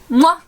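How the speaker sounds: a loud smacking kiss; blown kiss. Real, not acted. Signing off to a loved one with a loud blown kiss. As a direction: send a kiss down the line.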